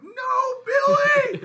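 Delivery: very high, very loud shout; piercingly high pretend cry of dismay; mock horrified scream. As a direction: scream it in hammed-up horror. Acted viewer's horrified cry.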